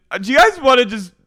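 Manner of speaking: high, loud, fast pleading; drunk Miranda. Retold or imitated. Miranda rallying the girls for wine, loud fast pleading. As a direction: rope everyone into the bottle. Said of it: "she goes".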